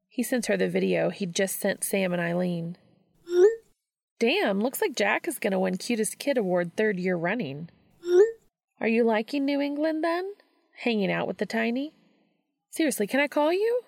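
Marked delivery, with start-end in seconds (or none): 4.2-13.8 put-on higher, lively young woman's voice; light, teasing and eager friend; female friend voice. Friend teasing Dean over video, eager and higher.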